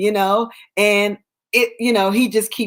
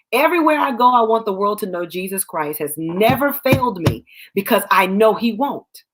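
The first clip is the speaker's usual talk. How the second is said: normal talking voice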